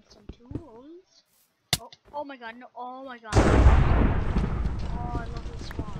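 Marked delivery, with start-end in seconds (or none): 3.4-5.1 excited yelp. Real, not acted. Sudden reaction to spawn.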